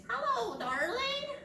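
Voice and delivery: high squawky parrot greeting. Shown as a demonstration. Librarian shows kids how parrots talk, high parrot voice. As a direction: say it like a talking parrot. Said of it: "That's how parrots sound".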